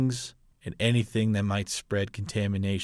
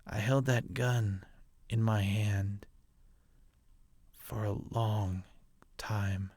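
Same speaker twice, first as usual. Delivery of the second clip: quiet confession; 7 dB quieter than usual. Acted. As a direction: confess it softly, almost breaking off. Father confesses killing his own son.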